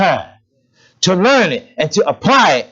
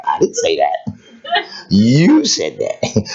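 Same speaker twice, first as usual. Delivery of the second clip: mock denial; 5 semitones lower, slower than usual. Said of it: "Y'all responded like".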